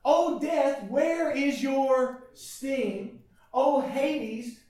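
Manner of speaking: loud, ringing pulpit cry; fiery preaching. Acted. Pastor to his church, preaching at full force.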